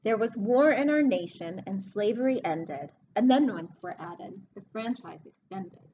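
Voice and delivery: storybook reading voice; reading a rhyming book to kids. Acted. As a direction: read the verse to the class, leaning on the rhyme. Teacher reads rhyming book aloud.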